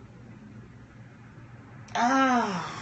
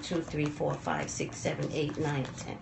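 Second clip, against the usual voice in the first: low, hushed count to ten; counting to calm down; 6 semitones lower, 11 dB quieter than usual. Real, not acted. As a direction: count softly to hold the anger back. Counting to calm her anger.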